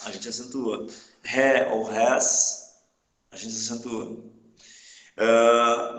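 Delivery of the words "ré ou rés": slow demo. Shown as a demonstration. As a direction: say Ré ou rés very slowly. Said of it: "slow teaching demo".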